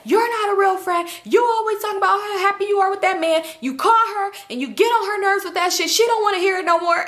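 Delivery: high, imitated angry rant; retold yelling. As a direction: tell her off as if replaying an argument. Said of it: "He yelling at her".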